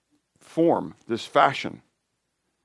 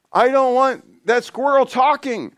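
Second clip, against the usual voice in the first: laughing, high voice; 12 semitones higher, 4 dB louder, slower than usual. Acted. Laughing about talking squirrels.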